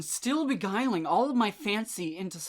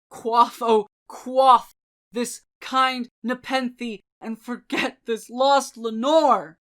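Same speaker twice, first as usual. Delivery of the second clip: loud and higher, pleading fiercely; impassioned cry; 3 semitones higher, 9 dB louder than usual. A narrator's reading. Grieving lover crying to himself to forget Lenore, loud. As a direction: urge yourself to forget, with passion. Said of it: "I cried".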